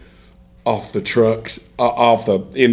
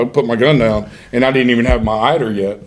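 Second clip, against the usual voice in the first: ordinary talk, nothing marked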